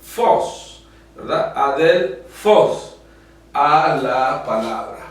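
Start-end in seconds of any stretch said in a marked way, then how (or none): 0.0-0.8 FOS punched out high; strong accented tone. Shown as a demonstration. Teacher demonstrating the acute accent's strong tone, high forceful fos. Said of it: "Le da ese tono fuerte". 1.6-3.0 adelfos slow and loud, FOS hit hard; strong final stress. Shown as a demonstration. Teacher showing students the acute accent, adelfos with forceful -fos. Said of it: "Le da ese tono fuerte".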